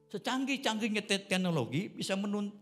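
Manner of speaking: normal talking voice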